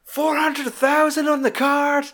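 high, drawn-out incredulous rant; mock rant. Film dad ranting in the boardroom, mock outrage. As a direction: imitate an outraged dad reading out the bill. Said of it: "it's his fucking rant".

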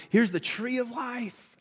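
high, drawn-out voice of God; put-on lofty God voice, slow and high. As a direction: stretch it out slowly, pitched well up. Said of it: "I don't know what God's voice sounded like".

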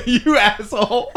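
high, slow, laughing through the insult; affectionate jab broken by laughter; cracking up at the cohost's nine eleven joke. Real, not acted.

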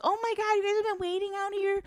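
high-pitched mock teacher. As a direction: squeal it like a flustered teacher. Teacher voiced in a story.

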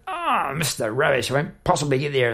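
a whiny sleepy moan, lightly done; mock whiny grumble. To the rubbish man, grumbling sleepily. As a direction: grumble like you've overslept. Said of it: "Rather than wake up and go".

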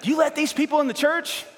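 a pastor mocking an email complaint, high and indignant; mock outraged churchgoer. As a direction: parody a scandalized member complaining.

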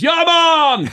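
high, bright-voiced Bahamian shop guy greeting; Bahamian accent. Health food store guy from the Bahamas greets the chef in a high Bahamian accent. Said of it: "guy from the Bahamas".

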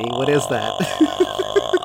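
slow, low ghostly moan; spooky voice. Shown as a demonstration. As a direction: drag it out like a haunting. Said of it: "make noises".

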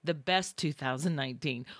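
ordinary delivery

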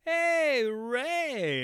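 high, drawn-out tipsy hail; overfamiliar greeting. Retold or imitated. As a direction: greet the celebrity like an old pal. Drunk, greeting a star in line.

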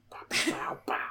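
breathy whispered gunshot noises; whispered pow sounds. Pretend. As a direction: whisper pow pow like a toy blaster. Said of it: "I thought you were doing a Big Baby impression".

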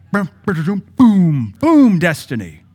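high loud slow boom. Retold or imitated. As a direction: slam each boom down. Mimicking sudden destiny.

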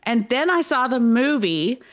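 ordinary delivery

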